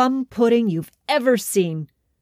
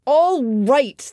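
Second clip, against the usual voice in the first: mild shout; 5 semitones higher than usual. A narrator's reading. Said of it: "the king shouted at last".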